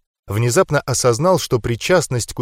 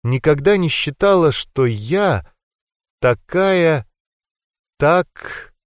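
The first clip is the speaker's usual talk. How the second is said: higher, hesitant, losing the sentence; faltering, exhaled; 3 semitones higher, slower than usual. A narrator's reading. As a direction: trail off word by word with long pauses. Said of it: "выдохнула она и нахмурилась".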